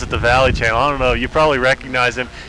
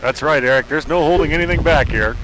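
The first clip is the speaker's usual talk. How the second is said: ordinary talk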